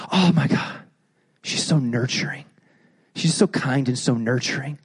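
hushed mock adoration of a nurturing wife; dreamy gushing. Husband gushing about wife before marriage.

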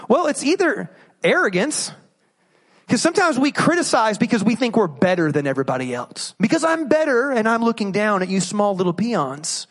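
usual speaking voice

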